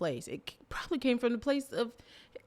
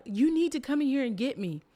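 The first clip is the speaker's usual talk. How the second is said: higher, insistent plea; freaking out; 6 semitones higher, 4 dB louder than usual. Retold or imitated. Stuck in the grocery store, begging the guide, panicky. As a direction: demand rescue, half panicking. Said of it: "I'm freaking out".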